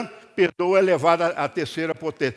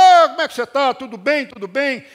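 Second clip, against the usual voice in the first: cheerful greeting; 5 semitones higher, 7 dB louder than usual. Retold or imitated. Greeting old colleague on street.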